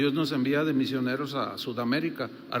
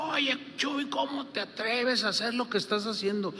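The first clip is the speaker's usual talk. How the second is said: higher mock voice of another pastor, reproachful; imitating the accusing caller; 8 semitones higher, 3 dB quieter than usual. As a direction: voice the accusing colleague, scolding. Pastor recounts accusing call. (imitando al pastor acusador, en tono de reproche)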